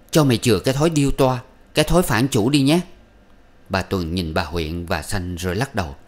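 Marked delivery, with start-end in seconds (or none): 0.1-2.8 fast, higher, louder scold while beating; angry scolding. A narrator's reading. Young wife whipping the servant, fast angry scolding. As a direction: spit it out in fury. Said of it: "vừa đánh vừa nói".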